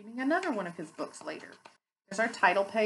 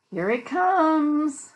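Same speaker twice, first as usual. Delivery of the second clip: high, loud and drawn out; storybook call-out; 6 semitones higher, 9 dB louder, much slower than usual. A narrator's reading. Teacher reads as the bus arrives, drawn-out call. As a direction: sing it out slowly to the children. Reading picture book aloud.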